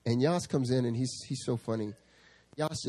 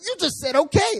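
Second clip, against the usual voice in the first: much higher, louder accusation; indignant accusation; 7 dB louder than usual. Retold or imitated. Zach accusing the pastor, high and loud. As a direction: jab the quoted word back at him. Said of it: "He's like".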